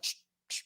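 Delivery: quick comic hushes; shushing. Retold or imitated. Comedy character interrupting every time someone talks, shushing. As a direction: imitate hushing at each attempt to speak. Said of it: "every time they say something".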